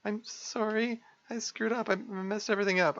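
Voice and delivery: high, stammering, near tears; distraught apology. Acted. Sayori apologising to Monica, high tearful stammer. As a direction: beg forgiveness in a panic.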